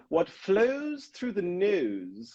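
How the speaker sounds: slow, drawn-out dialect exercise; high, over-enunciated cartoon impression; Groundskeeper Willie. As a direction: recite it like a pupil of a dialect coach. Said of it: "when Willie in The Simpsons is".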